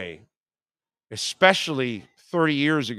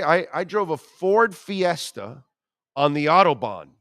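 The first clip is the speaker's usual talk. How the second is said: usual speaking voice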